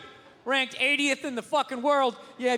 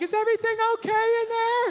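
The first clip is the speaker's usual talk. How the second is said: high, louder concerned wife voice; wife calling in; 10 semitones higher, 4 dB louder than usual. Retold or imitated. Comic's wife calling to him in the shower, high worried voice. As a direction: call through the door, worried and high. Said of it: "my wife's always just like".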